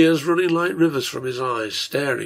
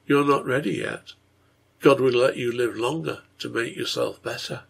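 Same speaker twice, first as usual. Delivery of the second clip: ordinary delivery, nothing marked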